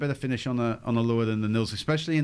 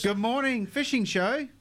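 cheery phone greeting; 7 semitones higher than usual. Real, not acted. Answering call on air.

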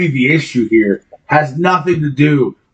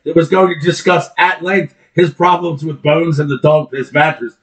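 ordinary talk

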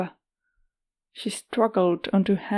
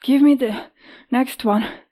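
raspy, pained; 5 semitones higher, 5 dB louder than usual. Acted. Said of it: "pained grimace".

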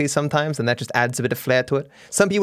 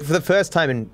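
usual speaking voice

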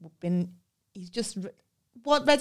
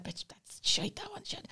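whispered aside, much softer; whispered verdict; 12 semitones higher, 10 dB quieter than usual. Retold or imitated. Wine teacher secretly judging cheap wine, whispered aside. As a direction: drop to a whisper to slag off the wine. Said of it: "And he's like".

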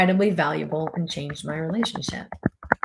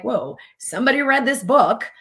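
higher, louder impressed reaction; mock amazement; 6 semitones higher, 5 dB louder than usual. People discovering the book's reviews, acted as amazed. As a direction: play an impressed onlooker.

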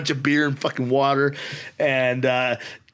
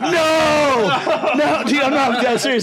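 high, loud, laughing protest; a hammed-up desperate no, half laughing; laughing panicked plea; 11 semitones higher, 5 dB louder, much slower than usual. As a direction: beg to stop through laughter, voice cracking high. Panicked refusal of fourth round.

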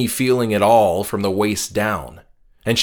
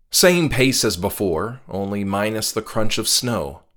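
usual speaking voice

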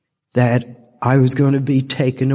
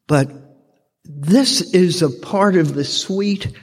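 usual speaking voice

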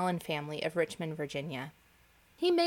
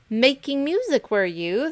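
higher, louder scornful jab; mocking cry; 6 semitones higher, 6 dB louder than usual. The ants sneer at the grasshopper, loud and scornful. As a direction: throw his excuse back at him. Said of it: "they cried".

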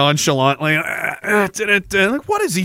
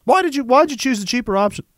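ordinary delivery

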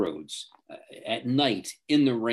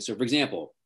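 usual speaking voice

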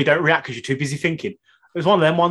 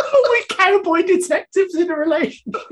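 high, slow, laughing echo; laughing repeat; 13 semitones higher, 5 dB louder than usual. Retold or imitated. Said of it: "You're right, though".

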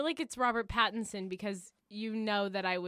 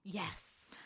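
soft hissed yes; whispered triumph; 10 dB quieter than usual. Real, not acted. Contestant pleased at a correct answer, whispered triumph. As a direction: celebrate under your breath.

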